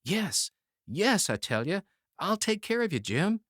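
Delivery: high, insistent urgent promise; protesting. Acted. Youth protesting to his dying friend, high and urgent. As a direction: insist fervently. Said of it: "protested the youth".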